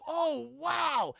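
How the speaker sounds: high wowed exclamation; amazed. Retold or imitated. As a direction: gasp it in wonder. Character amazed.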